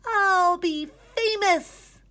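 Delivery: very high, very loud, slow crowing; triumphant kid crowing; crowed. A narrator's reading. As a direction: crow it in triumph. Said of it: "crowed Gregory".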